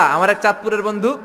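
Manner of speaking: ordinary delivery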